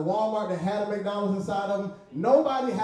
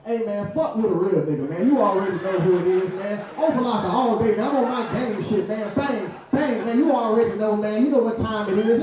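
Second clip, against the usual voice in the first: louder, hyped street swagger; 4 semitones higher, 4 dB louder than usual. Retold or imitated. Comedian showing off for the camera, loud swagger. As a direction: puff up and posture for the camera. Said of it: "As soon as somebody bring out a camera".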